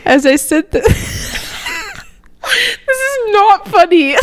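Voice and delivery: reading through giggles; can't keep a straight face; laughing while reading. Real, not acted. Friend reading a book's first line, laughing through it.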